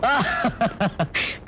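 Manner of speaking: high, light laugh; delighted laugh. Acted. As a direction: laugh fondly at the child's answer. Amused by little sister's argument. (உயர்ந்த குரலில் சிரிப்பு)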